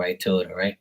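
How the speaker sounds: usual speaking voice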